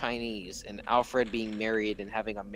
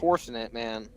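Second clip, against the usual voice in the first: ordinary talk, nothing marked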